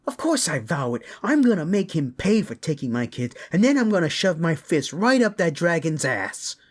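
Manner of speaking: loud, high, fired-up threat; enraged parent, voice leaping up; furious vow. Acted. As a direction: swear revenge at the top of your voice. Furious parent vows revenge on kidnapper.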